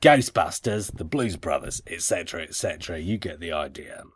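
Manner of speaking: put-on gravelly Australian accent. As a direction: let the fake Australian accent drop into gravel. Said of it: "you ended up more gravelly".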